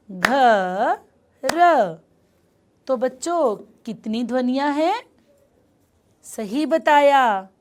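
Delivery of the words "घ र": घ and र said apart, one clap each; sounds split apart. Shown as a demonstration. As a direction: sound out घ, then र. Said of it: "ताली बजाकर अलग-अलग ध्वनियों में तोड़ें". (ध्वनियाँ अलग-अलग बोलना)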